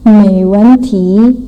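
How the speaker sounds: slow "Meiwenti": rising mei, falling wen, rising ti; "Meiwenti" said slowly, syllable by syllable in tones. Shown as a demonstration. Teacher models "Meiwenti" slowly for the class. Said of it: "Mei, nada dua".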